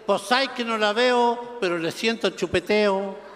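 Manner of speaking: slow Mexican singsong; hammed-up Mexican lilt; Mexican accent. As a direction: draw out the endings like a joke Mexican. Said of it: "conversaban dos mexicanos".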